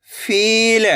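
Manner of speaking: फील with the ee stretched long; फील, held long for learners; drawn-out long vowel. Shown as a demonstration. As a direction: pull out the ee in फील. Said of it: "तो आपको खींच के पढ़ना है".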